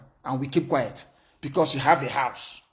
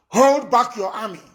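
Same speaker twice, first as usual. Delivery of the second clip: higher and louder, a hard commanding call; loud warning; 6 semitones higher, 6 dB louder than usual. Real, not acted. Leader warning the army to hold back, shouted. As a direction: order them to stop, full voice.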